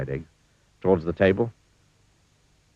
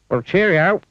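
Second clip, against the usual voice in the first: high, hearty thanks; breezy and friendly, slapping a back; Clumsy's farewell; 11 semitones higher, 5 dB louder than usual. A narrator's reading. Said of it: "said Mister Clumsy".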